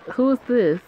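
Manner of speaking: ordinary talk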